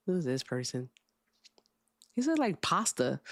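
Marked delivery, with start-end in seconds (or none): none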